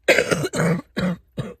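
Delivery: loud rough coughing fit; harsh cough. Real, not acted. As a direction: cough hard from the chest. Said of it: "Sorry for the nasal strip".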